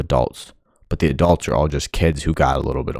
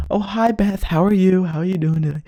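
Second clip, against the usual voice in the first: much higher, sing-song fake-friendly greeting; mock polite coworker; 5 dB louder than usual. Mocking suit-wearing workers greeting Beth, high sing-song fake cheer. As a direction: parody the nice office worker greeting a colleague.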